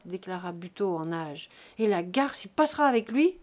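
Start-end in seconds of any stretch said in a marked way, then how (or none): 1.8-3.4 loud, bitter cry; a farmer cursing the calf and cow, angry; angry outburst. Acted. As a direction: throw out the curse loudly. Farmer desperate over dying cow.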